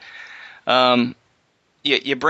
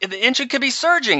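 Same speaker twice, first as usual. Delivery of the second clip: high-pitched mock scolding; imitated yelling; 12 semitones higher than usual. Pilot imitates her yelling at him over the engine, high mock scolding. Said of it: "And she's yelling at me".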